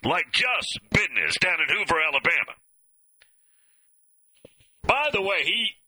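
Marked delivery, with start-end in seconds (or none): 0.9-1.4 "business" pronounced BID-ness; Southern "bidness". Shown as a demonstration. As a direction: swap the z in "business" for a d. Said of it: "not business. Business".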